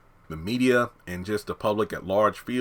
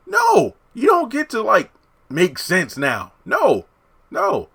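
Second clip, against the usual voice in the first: loud, high-pitched protest; indignant telling-off; exasperated objection; 11 semitones higher, 6 dB louder than usual. Acted. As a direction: shut them down, high and loud. Scolding the character for inconsistency.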